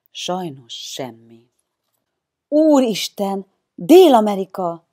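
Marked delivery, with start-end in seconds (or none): 2.5-4.8 loud, high startled exclamation; Casablanca frightened cry. A narrator's reading. Child startles the whispering gang, crying out in fright. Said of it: "kiáltott ijedten Casablanca".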